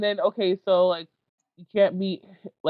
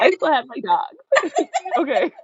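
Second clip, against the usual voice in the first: higher, slow, giggly delivery; laughing self-mockery; 10 semitones higher, 3 dB louder, slower than usual. Real, not acted. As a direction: laugh through the consolation. Jokes about being single.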